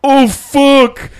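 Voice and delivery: loud, high mock yell of alarm. Storyteller imitates the rider's yell, high and loud. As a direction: yell it as you fall. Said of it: "he's like".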